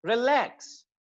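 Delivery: Relax with drawn-out, stressed "LAAX"; quick "ri", then hold the vowel in "lakhs" of relax; long second vowel. Shown as a demonstration. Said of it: "vowel sound आ है उसको मैंने long किया है".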